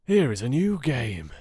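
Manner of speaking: put-on an old miser suddenly keen to play; loud, eager old Scrooge; Scrooge's eager voice. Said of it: "said Scrooge".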